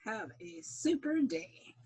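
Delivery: cheery sign-off. Acted. Outro sign-off.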